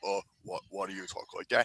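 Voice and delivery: puzzled low-voiced wrestler; John Cena impression. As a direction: ask it deep and hushed. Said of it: "impression of John Cena meeting Mister Casual".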